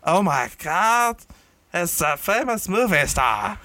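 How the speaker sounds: a starstruck townsperson spotting a celebrity, drawn out; crowd voice. Acted. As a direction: onlooker in the crowd spotting a star, hammed-up and slow. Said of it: "that's my crowd voice".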